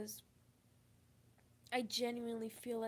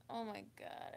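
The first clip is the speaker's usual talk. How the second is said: ordinary talk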